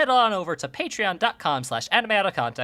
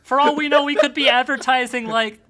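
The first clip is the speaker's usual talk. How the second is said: alarmed outburst; 6 semitones higher, 6 dB louder than usual. Real, not acted. Alarmed at advertising Nazis.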